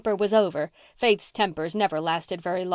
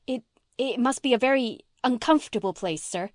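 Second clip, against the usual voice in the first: put-on higher girlish voice, halting start; flustered hesitation; 5 semitones higher than usual. A narrator's reading. Girl pressed for a reason, flustered and hesitant.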